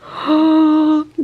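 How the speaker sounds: sharp loud delighted gasp; a big thrilled intake of breath; excited gasp. Real, not acted. Opening reveal of bracelets.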